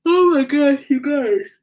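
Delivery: loud, high words through a yawn; real yawn swallowing the words. Real, not acted. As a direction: exclaim mid-yawn. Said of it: "I'm not yawning 'cause I'm bored".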